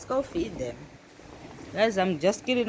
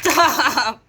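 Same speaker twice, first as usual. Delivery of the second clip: sudden loud, higher command; real scolding shout; 4 semitones higher, 7 dB louder than usual. Real, not acted. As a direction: yell to halt the animals. Scolding the animals.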